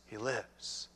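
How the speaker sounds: soft, low echo of the line; hushed repeat. Shown as a demonstration. Preacher repeating the promise, soft and low. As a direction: let it settle, quietly. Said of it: "As I'm speaking".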